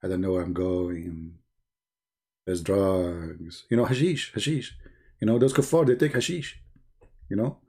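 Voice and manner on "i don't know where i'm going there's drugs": low, soft, dazed cat talking to itself; drugged cat voice. Retold or imitated. Mocking the cat in the video, low and dazed. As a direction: sound lost and drugged, mumbling low. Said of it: "she go, like".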